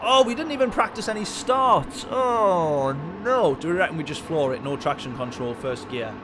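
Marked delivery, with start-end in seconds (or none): 0.0-3.6 high, loud sudden alarm; oh no, caught out; dismayed surprise. Real, not acted. Realises he never practised starts.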